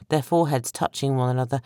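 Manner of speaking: plain reading voice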